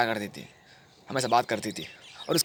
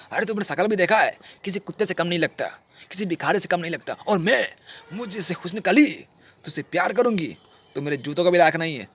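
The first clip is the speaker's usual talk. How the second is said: higher-pitched mocking disdain; scornful rejection; 4 semitones higher than usual. Rani insults the rejected lover, high scornful put-down. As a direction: imitate a girl tearing down a suitor.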